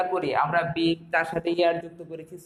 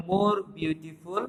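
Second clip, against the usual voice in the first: 1 slow model phrase; much slower than usual. Shown as a demonstration. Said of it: "comparative ফর্মে বসবে".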